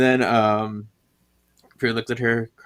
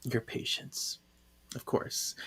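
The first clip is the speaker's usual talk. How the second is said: mild retold hush, almost whispered; hushed breakdown; 13 dB quieter than usual. Reading Piper breaking down, in a hushed voice. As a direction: let it drop to a soft, defeated whisper.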